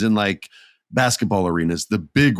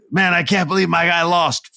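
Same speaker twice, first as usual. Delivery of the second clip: mock distraught fan; 9 semitones higher than usual. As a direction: whine like a gutted wrestling fan. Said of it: "they're not over there going".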